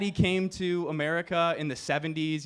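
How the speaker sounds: ordinary delivery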